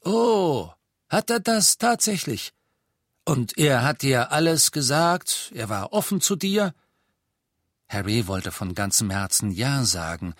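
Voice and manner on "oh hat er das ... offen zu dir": viel höher, spöttisch ungläubig; sarkastisch. Acted. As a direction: Zweifel höhnisch säen. Aberforth sarcastic. (Aberforth spottet über Dumbledore)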